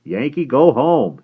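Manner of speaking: slow, high, loud jibe; chuckling tease. A narrator's reading. Husband teasing Aunt Fran after the rebuff, amused. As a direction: tease in a drawn-out singsong. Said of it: "Her husband chuckled".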